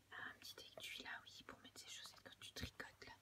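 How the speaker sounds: soft unvoiced whisper while unpacking. Real, not acted. Unboxing a gift parcel for her viewers, whispering. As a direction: hush it like someone might wake. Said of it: "je sais pas pourquoi je chuchote".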